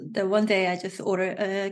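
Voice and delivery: usual speaking voice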